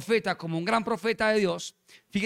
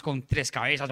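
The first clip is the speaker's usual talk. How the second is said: ordinary delivery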